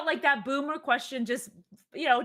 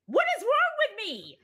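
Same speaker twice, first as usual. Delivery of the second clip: high, louder, laughing mock exasperation; frustrated self; 12 semitones higher, 5 dB louder than usual. Retelling iPad screen-share struggle, high laughing exasperation. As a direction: whine at the stubborn TV. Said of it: "I was like".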